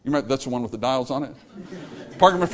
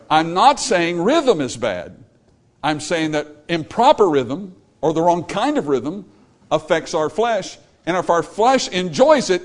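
slow, louder pulpit emphasis; preaching; 3 semitones higher, 4 dB louder than usual. Acted. Preacher warns congregation about music feeding the flesh, preaching hard. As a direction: drive each point home from the pulpit.

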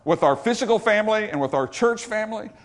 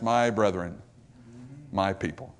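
much softer and lower, tender and fading; dying king's soft words to his people; tone of love; 10 semitones lower, 9 dB quieter than usual. Acted. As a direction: address them with quiet love. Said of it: "in this tone of love for them".

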